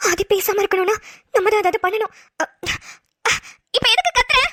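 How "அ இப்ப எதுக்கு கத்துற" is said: usual speaking voice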